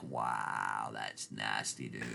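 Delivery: very low, soft disbelief; disgusted aside. Retold or imitated. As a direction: drop way down under your breath, grossed out. Retells his disgusted aside.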